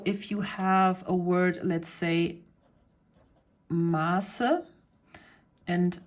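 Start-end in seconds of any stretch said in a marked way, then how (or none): 3.7-4.6 long drawn first vowel in Maße. Shown as a demonstration. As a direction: say Maße with an elongated A before the s sound. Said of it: "elongate the, va-, the vowel".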